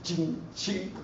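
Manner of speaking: soft mock preacher sound; a hushed imitation of the preacher; imitating the pastor. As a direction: quietly mimic the pastor's chant. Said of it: "how he was talking like this".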